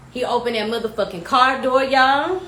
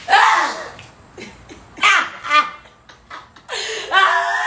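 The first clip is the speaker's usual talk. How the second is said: mock scream; 7 semitones higher, much slower than usual. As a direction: wail it like being dragged off. Screaming at arrest.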